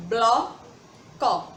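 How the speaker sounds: "Bloco" in two beats, a long pause between blo and co; syllable by syllable. Shown as a demonstration. Teacher has children count sounds, saying "Bloco" as two separated syllables. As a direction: punch out blo, then co, to make "Bloco". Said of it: "quantos sons eu falo".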